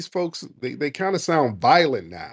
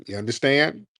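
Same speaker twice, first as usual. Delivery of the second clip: ordinary delivery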